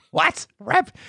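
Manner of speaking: high-pitched mock-startled echo; incredulous. Speaker retelling his reaction to being called rat pig, incredulous. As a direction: repeat it in high, acted disbelief.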